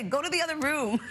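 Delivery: high, laughing command; shooing. Retold or imitated. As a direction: shoo him out of the room. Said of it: "I sometimes say".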